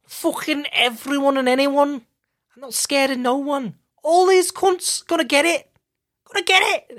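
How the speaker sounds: loud Scouse bravado impression, way up in pitch; cocky Scouse fighter trash talk, high and loud; Darren Till Scouse impression. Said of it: "Darren Till has the most imitable accent".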